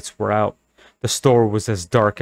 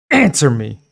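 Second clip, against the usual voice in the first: louder, higher shriek; 3 semitones higher, 6 dB louder than usual. Acted. Girl shrieking at the store owner. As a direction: shriek at him. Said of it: "Carly Beth shrieked".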